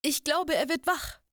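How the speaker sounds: high, squeaky little voice for Maki; squeaking troll woman. A narrator's reading. Troll woman Maki squeaks to the others about the waking man. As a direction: squeak it out like a nervous troll girl. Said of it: "quiekte Maki".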